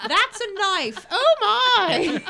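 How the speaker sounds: high, loud, laughing mock Australian; a jokey high Australian accent. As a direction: ham up an Australian voice through giggles.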